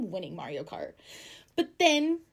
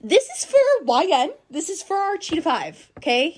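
loud, high hype announcement; shouted dedication; 8 semitones higher, 12 dB louder than usual. Real, not acted. Host dedicating a song to the listeners, shouting it out. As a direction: call it out big to the listeners.

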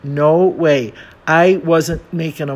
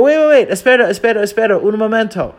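flustered correction; 6 semitones higher, 4 dB louder, much faster than usual. Retold or imitated. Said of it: "correcting himself again, frantic".

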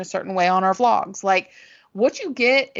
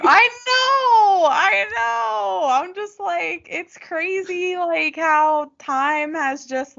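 high and slow, fighting tears; tearful; 9 semitones higher, 3 dB louder, much slower than usual. Real, not acted. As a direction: voice breaking with emotion. Tearful talk after friend nearly cries.

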